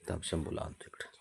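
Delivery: soft whispered aside. Real, not acted. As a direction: whisper it so nobody stirs. Aside while fetching the dog. (हळू कुजबुजत)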